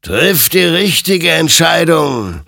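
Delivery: loud, high, slow warning; admonishing. A narrator's reading. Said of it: "ermahnte ihn sein Gegenüber". (laut und gedehnt ermahnend)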